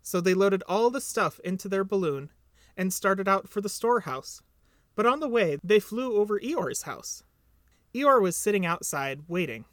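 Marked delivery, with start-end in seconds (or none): none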